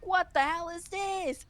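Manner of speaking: high-pitched, incredulous exclamation; mock-stunned, pitched well up; retold bewilderment. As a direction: act out being amazed by a discovery. Retold amazement at auctions.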